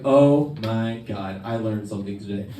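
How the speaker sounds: hushed mock revelation; dawning realisation. Acted dawning realisation, story's punchline.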